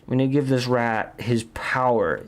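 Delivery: ordinary talk, nothing marked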